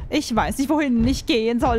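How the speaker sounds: usual speaking voice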